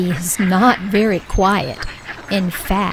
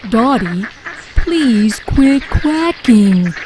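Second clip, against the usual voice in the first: louder, drawn-out plea; pleading quail; 4 dB louder, slower than usual. A narrator's reading. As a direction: beg as a pained little quail. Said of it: "says Quincy".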